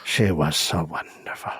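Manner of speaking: wistful breathy whisper. Pretend. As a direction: sigh it out dreamily.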